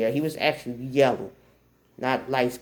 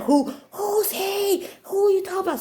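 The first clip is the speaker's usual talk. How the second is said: high, insistent questioning; demanding mom. Retold or imitated. Mom questions daughter about the boy, demanding.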